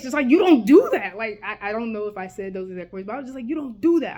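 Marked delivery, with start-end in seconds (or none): 0.3-1.2 loud, raised-pitch mock scream; acted outburst, loud and high; retold scream. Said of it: "I screamed in his face". 3.5-4.2 raised-voice re-enacted yell; louder, higher mock outburst; retold scream. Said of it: "I screamed dead in his face".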